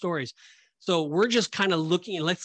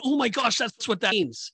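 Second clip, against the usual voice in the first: higher-pitched burst of sudden realization; amazed Matthew; 7 semitones higher than usual. Acted. Host imagines Matthew grasping the prophecy, amazed. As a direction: voice a mild gasp of discovery in Matthew's words. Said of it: "this is Matthew saying".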